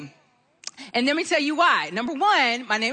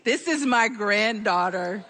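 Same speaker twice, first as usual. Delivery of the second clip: ordinary talk, nothing marked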